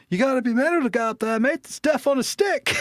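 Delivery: put-on high, fast, overdone Australian accent; hammed-up Aussie mate, mocking the film; bad Australian accent. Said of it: "really bad, um, Australian accent".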